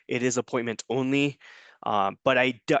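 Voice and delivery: usual speaking voice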